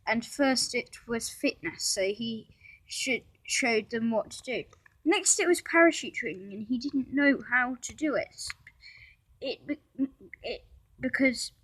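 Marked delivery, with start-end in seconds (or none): none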